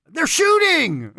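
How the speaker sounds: high, loud, drawn-out warning yell; alarmed yell. Acted. Runner warning bandmates of gunfire, high panicked yell. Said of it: "I yell out".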